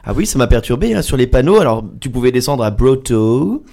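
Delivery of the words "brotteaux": French station name in a put-on English accent; exaggerated anglicised pronunciation; mock English accent. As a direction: say it like an English-speaking tourist. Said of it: "il fallait savoir parler anglais".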